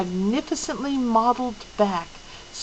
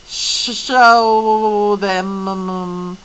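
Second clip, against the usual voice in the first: slow, stretched, echoing command; a strange ancient being giving an order; drawn-out otherworldly voice; eerie Mrs Which; 7 dB louder, much slower than usual. Acted. Missus Witch's odd commanding voice.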